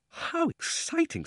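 put-on very high, drawn-out girlish thrill. Polly hears of the mysterious uncle, thrilled. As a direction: breathe wonder into it, slow and high.

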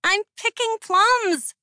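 put-on high, slow playful child's shout; girl shouting, laughing. Girl in the plum tree calls to the queen, high playful shout. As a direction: call back carefree from up the tree. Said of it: "the girl shouted, laughing".

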